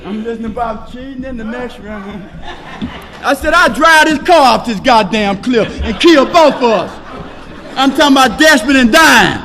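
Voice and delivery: high, loud, driving pulpit delivery; shouting testimony from the podium to the room; full-force preaching. Acted. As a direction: preach it to the back row.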